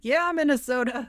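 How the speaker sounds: mock New York accent. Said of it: "the New York accent being like".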